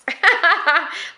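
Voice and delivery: bright burst of laughter. Real, not acted. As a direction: laugh out loud. Laughing at her own confession.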